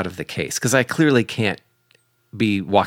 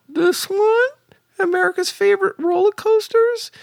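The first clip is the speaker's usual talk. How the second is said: put-on much higher voice of a nervous fourteen-year-old boy. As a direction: go way up high like a kid trying to sound innocent. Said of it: "that's how I sounded when I was, like, fourteen".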